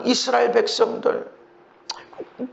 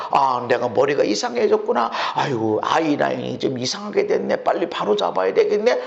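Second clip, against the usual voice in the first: mirror self-talk; 8 semitones lower than usual. Retold or imitated. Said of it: "그러고 거울을 보고".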